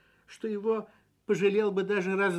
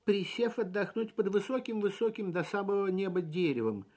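ordinary delivery, nothing marked

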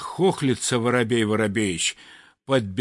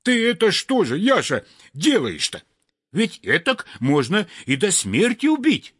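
scolding; 8 semitones higher than usual. Acted. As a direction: tell him off sharply. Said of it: "и сейчас бранится". (возмущённо бранится высоким голосом)

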